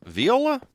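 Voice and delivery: Viola? repeated as vee-oh-la, high and questioning; doubtful echo. Real, not acted. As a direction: query the strange word. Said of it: "I've always heard it called viola".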